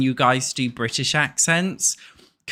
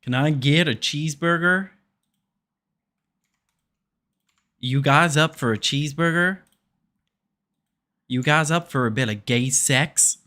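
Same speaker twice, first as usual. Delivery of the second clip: put-on Southern accent. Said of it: "How good's my Southern accent?".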